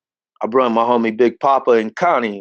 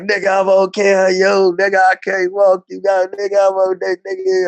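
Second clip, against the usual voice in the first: reassuring; 7 semitones higher than usual. Retold or imitated. Reassuring crying friend.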